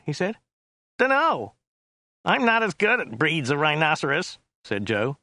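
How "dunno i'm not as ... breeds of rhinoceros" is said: high-pitched American cabbie; offhand driver. Acted. Driver admitting ignorance of rhinoceros breeds, offhand high voice.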